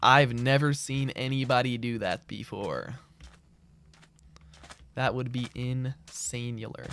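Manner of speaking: very slow, stretched southern drawl; put-on southern accent. Streamer finding a southern accent hard, slow mock southern drawl. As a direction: drag the words out like a mock Southerner. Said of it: "It's hard to talk with a southern accent".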